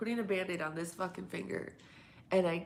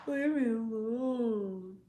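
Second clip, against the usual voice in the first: mock whine; 6 semitones higher, much slower than usual. Groaning at nagging chat.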